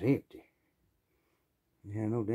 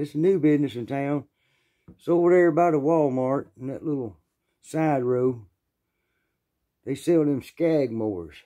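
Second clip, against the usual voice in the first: boss giving directions; 5 semitones higher, 7 dB louder than usual. Retold or imitated.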